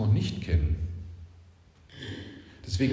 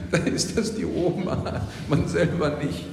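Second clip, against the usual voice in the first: laughing; 2 semitones higher than usual. Real, not acted. Laughing through remark.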